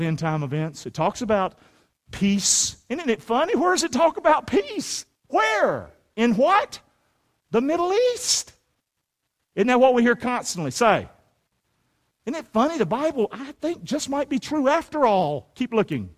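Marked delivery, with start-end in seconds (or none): 2.9-8.5 high, mock-wondering questions; feigned puzzlement. Preacher quizzes congregation on prophecy, feigned puzzlement. 12.3-15.5 high, slowing mock-surprised musing; feigned wonder. Preacher hints Bible might be true, feigned wonder.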